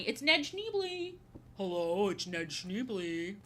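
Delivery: lightly hammed-up, drawn-out character greeting; slow, stretched-out mock introduction; Ned Schneebly. Streamer introducing himself as a film character, lightly hammed-up voice.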